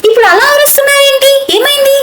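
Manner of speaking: high-pitched innocent surprise; acted worried questioning; feigned alarm. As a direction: ask as if bewildered by the fuss. Reacts to mother-in-law's screaming.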